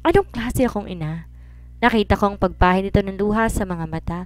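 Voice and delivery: plain reading voice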